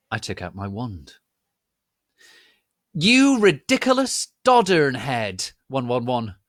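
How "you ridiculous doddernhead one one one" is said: high loud hammy yell. A narrator's reading. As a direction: yell it like a stage villain. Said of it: "Snoop yielded".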